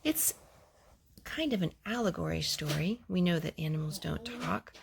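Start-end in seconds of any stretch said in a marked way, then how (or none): none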